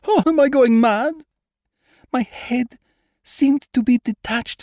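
very high-pitched, distraught confession; near hysteria. A narrator's reading. Lady tells doctor her nightmare, near hysterical. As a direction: climb into panic, breaking out loud at the peak. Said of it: "She shuddered and choked down an hysterical sob".

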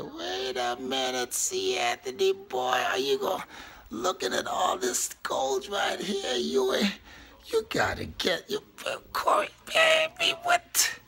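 falsetto self-scolding voice; high squeaky inner voice. Pretend.

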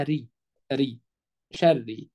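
ري as a thin "rri", then شري; light ر with kasra. Shown as a demonstration. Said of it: "الراء دي تحتها كسرة".

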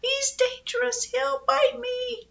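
put-on very high, thin-volume little-girl squeal; frightened squeal. A narrator's reading. Girl crouched against a chair from the dog, high squeal. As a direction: squeal in acted panic, drawing out the end.

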